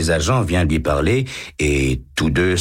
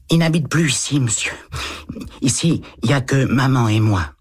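put-on higher, timid little boy's voice; a small boy answering a policeman shyly; 9 semitones higher than usual. A narrator's reading. Eight-year-old boy answers the lieutenant, timid child voice.

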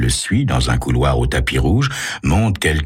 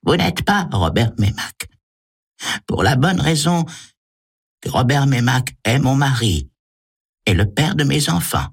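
high female accuser's voice; woman's voice; 10 semitones higher than usual. A narrator's reading. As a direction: throw out a high-pitched denial as a woman. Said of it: "une voix de femme".

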